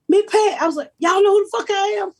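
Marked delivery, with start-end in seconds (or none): 0.1-0.6 high-pitched retold call. He echoes the cast hailing her, calling out. Acting out being hailed by name. 1.0-2.1 high, rushed burst of disbelief; retold amazement, racing and pitched way up; astonished. To the cast who recognized her, astonished.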